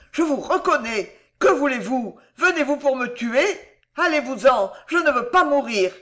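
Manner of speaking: high, loud pleading; utmost terror. A narrator's reading. Madman begs his torturer to go, high and terrified. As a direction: beg for life in panic. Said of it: "hurla le fou au comble de l'épouvante".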